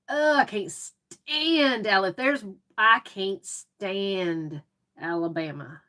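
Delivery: very slow, drawn-out loathing; exasperated. Real, not acted. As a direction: drag the words out in exasperation. Rant against rival Alabama team.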